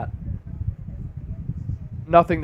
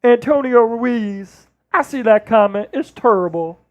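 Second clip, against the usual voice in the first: emphatic sportscaster caricature with stretched words; Charles Barkley. Acted. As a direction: grumble it as the ex-player analyst. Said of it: "That's the Charles Barkley".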